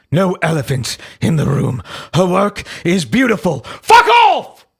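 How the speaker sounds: put-on British accent exploding at the end. Host as Buckby defending her work, British voice turning to shouting. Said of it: "read Buckby's voice in a British voice".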